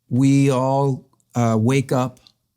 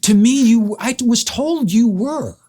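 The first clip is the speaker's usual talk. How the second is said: much higher and slowed, incredulous and hurt; stunned protest; 10 semitones higher, slower than usual. Real, not acted. Telling the friend who called that he had died, high and incredulous. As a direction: plead it like you still can't believe it. Insisting he had been told so.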